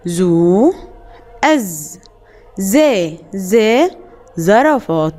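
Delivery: slow syllable-by-syllable drill of زا زي زو; sound drill. Shown as a demonstration. Teacher drilling children on the zay sounds. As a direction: drill each letter sound slowly, then the word. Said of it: "ننطق الأصوات تاني".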